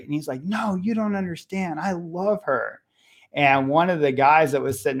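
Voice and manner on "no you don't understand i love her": higher-pitched, mock-indignant protest; mildly angry child. Son protesting to his dad, mildly indignant. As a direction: plead it like an upset kid, slowing at the end. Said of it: "he gets angry".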